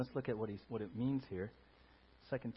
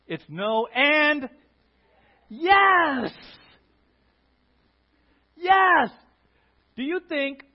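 ordinary talk

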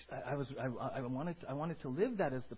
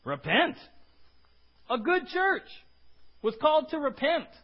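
emphatic; 11 semitones higher, 6 dB louder than usual. Real, not acted. Preacher emphasizing point.

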